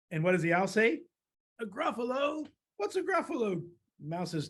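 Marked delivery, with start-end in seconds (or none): 1.6-3.7 high questioning owl voice; puzzled owl. Acted. Owl hears of a Gruffalo from mouse, puzzled and high. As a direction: pipe it up in bewilderment.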